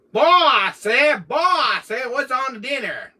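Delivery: Foghorn Leghorn. Retold or imitated. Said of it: "what he asked the Colonel".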